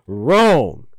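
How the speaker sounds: loud game-show "wrong"; loud buzzer call. Pretend. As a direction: boom it like a quiz buzzer. Quiz host marking wrong answers.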